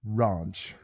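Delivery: Ranch with a long British ah, rahnch. Shown as a demonstration. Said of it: "Should I say it anyway?".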